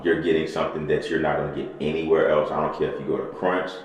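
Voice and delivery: ordinary talk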